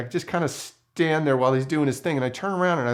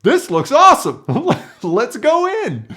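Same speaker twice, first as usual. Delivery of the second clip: much higher and louder, gleeful with a laugh; thrilled; 11 semitones higher, 9 dB louder than usual. Retold or imitated. Young scout at the scary camp gate, gleefully thrilled. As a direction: sell the bad idea as the best idea ever. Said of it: "So of course I'm like".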